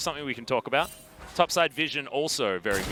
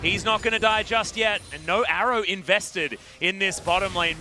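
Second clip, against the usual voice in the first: raised, louder esports commentary; excited play-by-play; 7 semitones higher, 3 dB louder than usual. Real, not acted. Caster calls a headbutt combo landing, excited play-by-play. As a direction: call the fight as it unfolds, pitch held high. Live play-by-play of a team fight.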